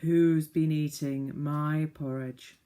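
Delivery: slow, loud gruff bear voice; angry father bear. Acted. Father bear asking about his porridge, slow and loud. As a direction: boom it slowly like an annoyed big bear. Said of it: "said the father bear angrily".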